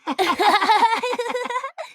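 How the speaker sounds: put-on high, hearty boyish laugh; burst of laughter. A narrator's reading. Two boys laughing at the bleating sheep, hearty laughter. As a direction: laugh out loud together. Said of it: "burst out laughing".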